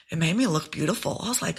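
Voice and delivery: ordinary talk, nothing marked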